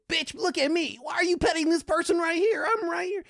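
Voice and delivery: high, loud diva dog demanding attention; sassy dog voice. Retold or imitated. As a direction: voice the jealous chihuahua, way up high. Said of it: "the other one's just like".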